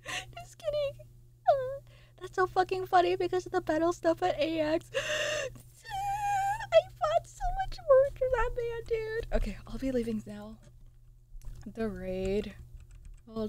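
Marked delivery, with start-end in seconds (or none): 0.1-9.3 high, cracking laugh-cry words; laughing to tears. Real, not acted. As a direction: talk through tears of laughter. Laughing till crying.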